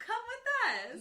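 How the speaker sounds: mock guard order. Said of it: "they're like".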